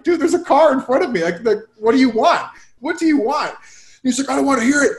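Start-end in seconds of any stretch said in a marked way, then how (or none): 0.0-3.5 high, exasperated retort; mock exasperation. Driver argues back at an angry stranger, acted exasperation. 4.3-4.9 high-pitched mock abuse before storming off; imitated angry tirade. Stranger dismisses the driver then storms off, imitated anger. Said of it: "he, like, storms off".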